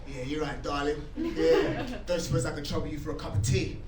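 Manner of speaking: put-on low, soft London hard-man accent; lightly done Cockney tough guy ordering tea; Cockney geezer in a cafe, toned down; Jason Statham. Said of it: "I turn into Jason Statham".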